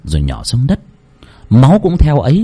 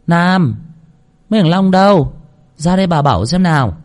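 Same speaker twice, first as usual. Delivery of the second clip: put-on calling old lady; 7 semitones higher than usual. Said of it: "giọng của bà Màu vang lên".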